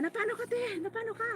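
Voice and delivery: high, worried question from the brother. Retold or imitated. Brother on the phone asking what happened, high and alarmed. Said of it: "sabi niya".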